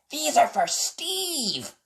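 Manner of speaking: high, slow puppy voice; little puppy offering presents; Spot's voice. A narrator's reading. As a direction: pitch Spot up and take it slowly. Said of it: "said Spot".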